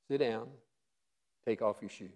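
understated divine instruction; hushed command. Acted. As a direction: say it small and quiet. God's humble request voiced.

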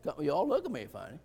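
ordinary talk

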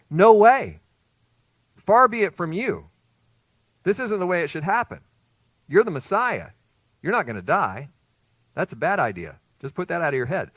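loud, high alarmed protest from Peter. Retold or imitated. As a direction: shout it like a disciple who refuses to hear it. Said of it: "Peter responded and said".

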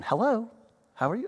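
mother's sudden honeyed phone greeting; a hint of mom answering the phone sweetly. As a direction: switch to polite and sweet for the caller. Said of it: "she picks up the phone".

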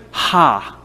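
a single short scornful "Ha"; scornful laugh. Acted. The world scoffs at the preacher: one scornful "Ha". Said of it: "A scornful laughing".

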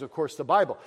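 usual speaking voice

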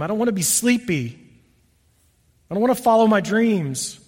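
usual speaking voice

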